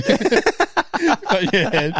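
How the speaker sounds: laughing tease. Pretend. Mocking the head pat.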